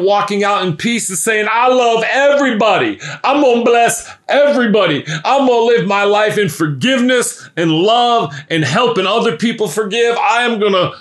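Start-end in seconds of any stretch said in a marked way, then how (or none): 1.5-10.9 high, bright rush of gratitude; joyful forgiven man. Acted. Forgiven man telling the world he loves everybody, excited and high. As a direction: gush with thanks, lifted up.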